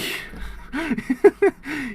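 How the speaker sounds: high-pitched burst of laughter; real giggling fit; helpless laughter. Real, not acted. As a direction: crack up mid-sentence. Cracking up after the rant.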